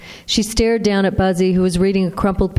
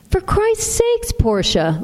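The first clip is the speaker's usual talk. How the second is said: much higher, slow exasperated snap; snapped; slower than usual. Acted. Mother snapping at her daughter for scaring her son, slow and high. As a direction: bite off the words at your daughter. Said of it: "Louise snapped".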